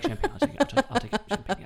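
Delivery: high, hurried, tripping over words; flustered. Retold or imitated. Embarrassed passenger grabbing champagne, flustered and high. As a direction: blurt it out in a nervous rush. Flustered reply to flight attendant.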